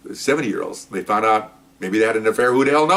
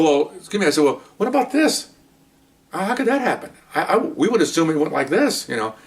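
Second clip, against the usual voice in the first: mock innocent; 8 semitones higher than usual. Innocent mock questioning of detective.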